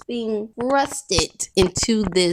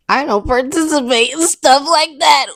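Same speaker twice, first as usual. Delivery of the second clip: higher, louder, giggling retry; fail to keep a straight face; laughing through it; 7 semitones higher, 7 dB louder than usual. Real, not acted. Retrying a straight-faced denial, still laughing. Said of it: "I'm gonna do it again".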